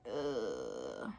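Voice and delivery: a low, quiet, disgusted ugh; disgusted groan. Retold or imitated. Reader reacting to a cheating plot, low disgusted groan. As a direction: groan it out in distaste.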